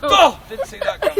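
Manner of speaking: high, loud mock surprise. Medieval victim shot with an arrow, mock surprised. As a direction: react with hammed-up surprise at being hit.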